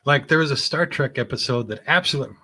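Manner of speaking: ordinary delivery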